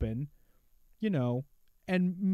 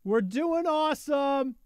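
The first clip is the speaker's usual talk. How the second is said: high, slow, forced cheer; fake cheerful; 8 semitones higher than usual. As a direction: sell it with a too-bright grin. Ironic everything-is-fine bit.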